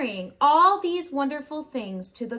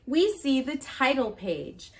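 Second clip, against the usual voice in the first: plain reading voice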